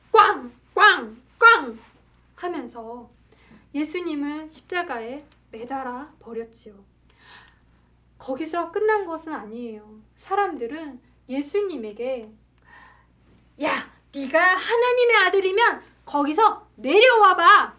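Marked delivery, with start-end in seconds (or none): 0.1-1.8 loud, slow hammer bangs; hammering noise. Acted. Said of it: "망치질을". 13.6-17.7 loud, raised-pitch jeer; mocking taunt. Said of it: "이렇게 놀리고 예수님을 욕되게 했어요".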